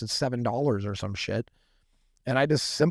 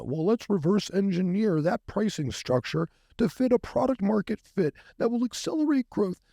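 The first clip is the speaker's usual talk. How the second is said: much higher, mocking Ivy League analyst tone; mock business-school voice; 9 semitones higher than usual. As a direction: send up a polished corporate analyst. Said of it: "I'm not a polo shirt wearing ... Ivy League school having".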